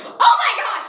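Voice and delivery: high, loud cry of alarm; mock panic. Six-year-old seeing his bloody finger, hammed-up panic. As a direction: play up a kid's sudden panic.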